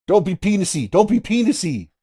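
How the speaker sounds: high-pitched mock movie warning; Peter from Family Guy. Quoting a Family Guy parody of Star Wars, high-pitched imitation. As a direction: push it up high for a cartoon parody line. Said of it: "there's Peter going".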